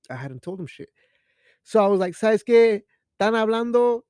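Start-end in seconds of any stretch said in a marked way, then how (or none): 2.1-4.0 loud, high bossy orders; dictator. Retold or imitated. Stand-in leader ordering a gang of coworkers, bossy dictator. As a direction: order the crew around like a dictator. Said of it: "feel like a fucking dictator".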